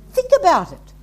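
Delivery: urgent exhortation. Real, not acted. Preacher's urgent call.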